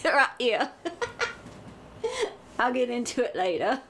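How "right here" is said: high, laughing Cockney call; a giggly Cockney voice shouting up; Cockney call, breaking into laughs; Cockney accent. Acted. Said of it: "what kind of accent I'm gonna do".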